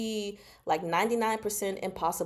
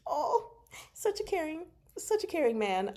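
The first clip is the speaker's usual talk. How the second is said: gushing fangirl; 9 semitones higher than usual. Real, not acted. Gushing over romantic scene.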